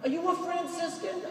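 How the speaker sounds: put-on an old Long Island woman calling to the friar, in a high Long Island accent. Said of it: "That's a Long Island accent".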